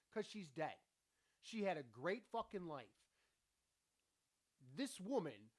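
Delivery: ordinary talk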